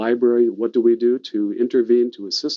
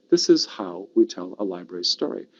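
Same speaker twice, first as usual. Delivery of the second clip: ordinary delivery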